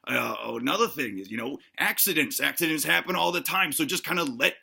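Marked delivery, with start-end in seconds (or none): none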